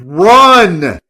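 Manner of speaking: high, loud urgent scream. A narrator's reading. Warning his brother to escape the thugs, screaming. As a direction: yell at him to flee. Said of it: "and I screamed".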